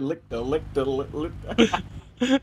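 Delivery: drawn-out, stuck-record stutter; mocking slip-up imitation. Teasing a friend's fumbled words, mock stammer. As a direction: mimic his tongue-tangle, dragging the words. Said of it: "That boy said".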